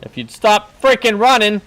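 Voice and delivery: loud, high-pitched complaint; exasperated. Real, not acted. Gamer chasing teammates who flee, exasperated shout. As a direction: plead loudly, fed up with the chase.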